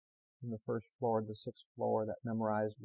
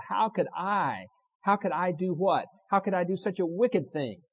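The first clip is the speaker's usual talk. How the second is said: building sermon; 4 semitones higher, 8 dB louder than usual. Acted. Preacher pressing the congregation, building to full-force preaching. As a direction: build each I louder and higher.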